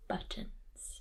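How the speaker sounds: whispered reveal; hushed narrator whisper. A narrator's reading. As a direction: breathe the word out, unvoiced.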